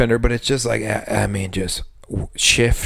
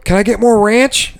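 higher, pushy customer voice; mock customer request; 8 semitones higher than usual. Bartender mocking a customer asking for ranch, higher pushy voice. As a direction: ask it like a needy diner.

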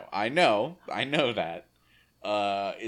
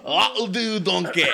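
loud, higher hammed-up Scottish voice as Shrek; Scottish accent; 9 semitones higher, 8 dB louder, slower than usual. Host doing Shrek to the cohosts, loud mock Scottish. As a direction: boom it out in a mock Scottish brogue. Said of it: "No, that's Scottish".

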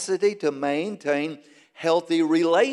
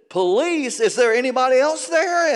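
higher, louder mock astonishment; alarmed caller; 8 semitones higher, 4 dB louder than usual. Caller hears police are at the house, mock alarm. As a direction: voice jumps up in acted disbelief.

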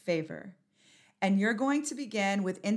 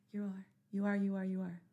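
ordinary delivery

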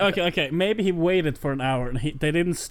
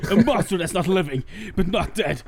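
edgy rant. Retold or imitated. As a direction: rant like a wannabe dark lord. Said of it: "edgy, super mad rant".

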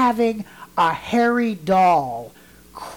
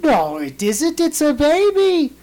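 higher, louder, slower insisting voice; insisting grandmother; 10 semitones higher, 6 dB louder, slower than usual. Retold or imitated. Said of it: "And she goes".

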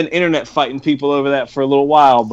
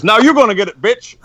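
higher-pitched mock demon taunt; demon in lineup; 6 semitones higher than usual. Joking about demon next in the lineup, hammed-up taunt.

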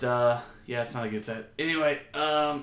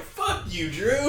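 mock insult; 7 semitones higher, much slower than usual. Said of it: "then they're like".

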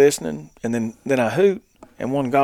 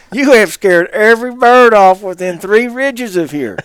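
high, loud, slow telling-off; exasperated scolding; 7 semitones higher, 9 dB louder than usual. Retold or imitated. Retelling his scolding of screaming Brody, loud slow exasperation. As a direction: scold in mock outrage, stretching it out.

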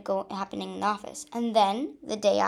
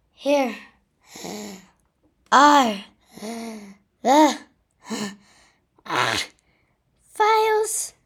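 panted; 8 dB louder, much slower than usual. Acted. Narrator out of breath handing files to Diane, panting. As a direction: gasp each word after running. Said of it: "I panted".

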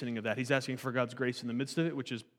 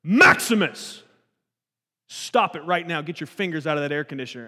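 dad voice; 8 dB louder than usual. Retold or imitated. Dad stopping son's fingers in AC unit, loud stern dad voice. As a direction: bark the order so kids snap to attention. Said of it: "The deepest dad voice you've ever heard".

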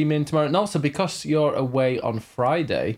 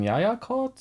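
higher-pitched quote of a friend; imitating streamer friend; 8 semitones higher than usual. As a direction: lightly mimic her cheery Norwegian. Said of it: "So she was going".

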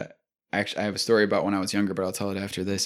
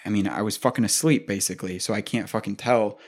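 ordinary talk, nothing marked

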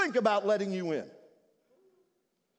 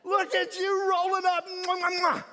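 high, loud, delighted welcome; father welcomes his lost son, overjoyed; joyful father; 8 semitones higher, 3 dB louder, slower than usual. Acted. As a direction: gush over the returning son. Father greets prodigal joyfully.

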